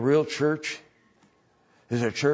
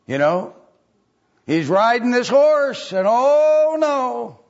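dramatic dread; 6 semitones higher, 6 dB louder than usual. Retold or imitated. Gary reacting to the pastor's new idea, hammed-up dread. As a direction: ham up the weary complaint. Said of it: "as dramatically as it probably was".

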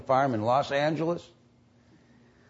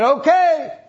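much higher, louder okay; eager okay; 6 dB louder than usual. Retold or imitated. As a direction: answer with bright eagerness. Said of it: "he said".

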